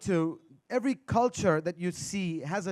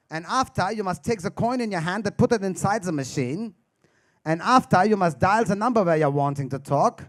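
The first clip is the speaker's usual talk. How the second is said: put-on louder, stiff German accent instructions; 3 semitones lower, 3 dB louder than usual. German man explaining a payphone to comedian, German accent. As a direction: drill each step like a German manual. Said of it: "He says".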